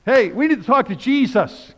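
loud, high shout for attention; calling out. Acted. Jesus' family outside wanting to talk to him, calling out. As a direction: call out insistently over a crowd.

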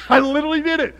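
high, loud protest of honesty; loud exclamation. Real, not acted. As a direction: insist it at full volume. Loud boast to the crowd.

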